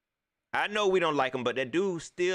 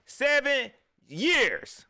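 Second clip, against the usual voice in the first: slow, higher, louder "Seven years"; drawn-out ominous warning; 9 semitones higher, 5 dB louder, much slower than usual. Real, not acted. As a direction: stretch "Seven years" out like a prophecy.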